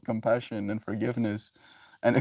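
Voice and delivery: ordinary talk, nothing marked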